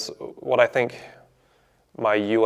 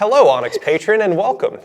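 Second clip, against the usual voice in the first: high, bright cashier greeting; chirpy barista welcoming a customer; customer service voice; 9 semitones higher, 6 dB louder than usual. Acted. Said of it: "You haven't heard my customer service voice".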